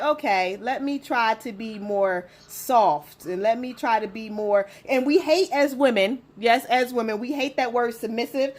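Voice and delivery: ordinary talk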